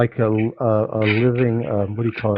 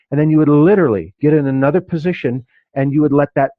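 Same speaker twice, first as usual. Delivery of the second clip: ordinary talk, nothing marked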